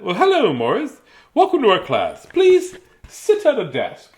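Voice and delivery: high, sing-song teacher; lightly voiced kind teacher.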